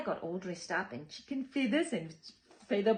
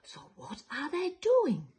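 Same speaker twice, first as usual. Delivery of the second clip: lively picture-book storytelling; reading to children; 2 semitones higher, 9 dB louder, slower than usual. A narrator's reading. Storyteller reading to children, lively and sing-song. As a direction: read it like bedtime story time for little ones.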